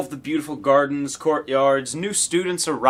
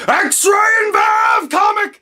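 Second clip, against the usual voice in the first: drawn-out, much higher, louder fan shout; excited shout; 7 dB louder, much slower than usual. Shown as a demonstration. Admitting the real excitement, shouting the comic title. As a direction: yell the title like a thrilled fan. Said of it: "Ah, who am I kidding?".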